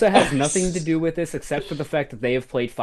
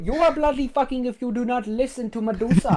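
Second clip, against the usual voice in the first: higher, exaggerated Indian accent; 10 semitones higher than usual. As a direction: scold in a hammed-up Indian accent. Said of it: "you do it so fucking well".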